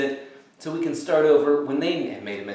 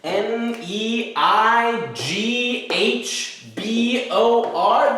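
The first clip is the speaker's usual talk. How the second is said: letter by letter, very slow and deliberate; spelling it out slowly; 4 semitones higher, much slower than usual. Shown as a demonstration. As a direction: spell each letter with a pause. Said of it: "Neighbor actually is".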